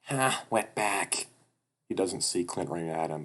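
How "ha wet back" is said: slow, higher-pitched taunt; acted mocking laugh-line; mocking jeer. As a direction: jeer at him, drawing it out. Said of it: "who points and laughs".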